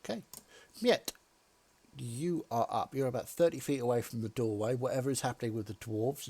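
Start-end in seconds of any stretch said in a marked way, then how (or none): none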